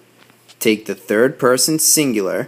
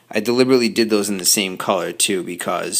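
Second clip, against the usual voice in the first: ordinary talk, nothing marked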